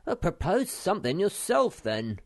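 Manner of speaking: loud, high indignant snap; angry. A narrator's reading. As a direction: snap back in annoyance. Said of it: "said Moomin Troll angrily".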